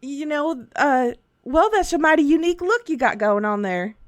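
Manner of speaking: put-on folksy Southern shopkeeper accent. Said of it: "transitioned to a Southern dude".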